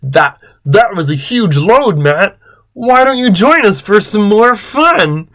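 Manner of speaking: full-voiced character line, not breathless; high, swooping playful invitation; Chris. A narrator's reading. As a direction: invite in a put-on voice, climbing high.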